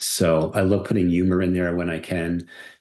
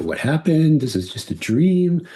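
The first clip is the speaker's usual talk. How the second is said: high, emotional dreamer's lament; higher, heartfelt disbelief in character; acting Elena's disappointment; 8 semitones higher than usual. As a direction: voice her dismay on waking from a dream. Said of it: "Elena's feelings when she woke up".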